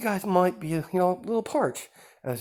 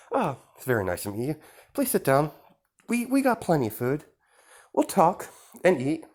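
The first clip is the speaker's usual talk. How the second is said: smooth villain host; 7 semitones higher, 3 dB louder than usual. Acted. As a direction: greet the guests with false charm, pitched up. Villain host invites to eat.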